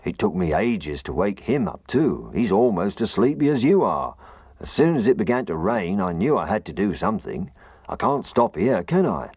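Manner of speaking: loud, high gruff snowman; a fretful snowman calling upward. Acted. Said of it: "He had a very gruff voice".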